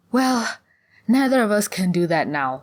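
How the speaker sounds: high, loud grumble; moaning complaint. A narrator's reading. Sulon kicks the moss, moaning in frustration. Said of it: "Sulon moaned".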